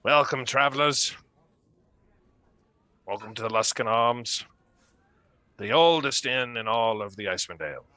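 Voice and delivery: booming hearty welcome from behind the bar; innkeeper greeting. Acted. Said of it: "human man behind the bar that says".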